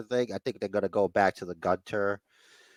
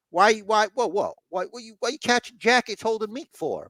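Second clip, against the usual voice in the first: high-pitched, stuttering mock protest; mock indignant questioning; 10 semitones higher than usual. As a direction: imitate someone baffled and demanding answers. Retelling a wrestler's indignant questioning.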